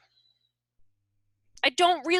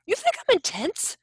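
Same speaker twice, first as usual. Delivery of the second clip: mock surprise; 7 semitones higher than usual. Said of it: "I said".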